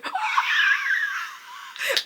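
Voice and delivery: sharp swooning gasp; excited gasp. Real, not acted. Gushing to viewers about a beloved character, excited gasp.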